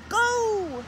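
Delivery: loud high shout. Acted. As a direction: leap out yelling. Pounce shout.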